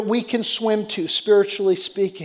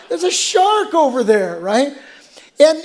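usual speaking voice

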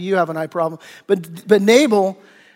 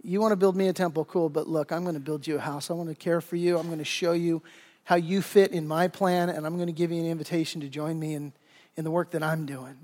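much softer, reassuring promise; gentle, quiet divine reassurance; tender God; 4 semitones lower, 9 dB quieter than usual. Acted. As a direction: speak like a loving father. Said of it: "God says".

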